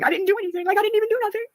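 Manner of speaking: high, indignant disbelief; incredulous protest. Real, not acted. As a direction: plead innocence in disbelief.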